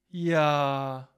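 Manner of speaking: demonstrated happy yes. Shown as a demonstration. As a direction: stretch out "Ja" with delight. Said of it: "glücklich".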